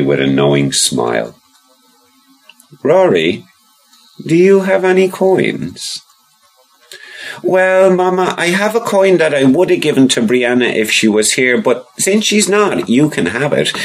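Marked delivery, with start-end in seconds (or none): 2.8-6.0 high, sly mother voice. Acted. Mama asks Rory. 7.4-13.7 put-on high little boy voice; child voice. Rory offers coin.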